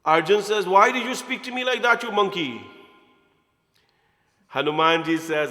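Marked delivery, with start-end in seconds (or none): 0.7-2.6 offended retort. Acted. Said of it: "Arjun became offended".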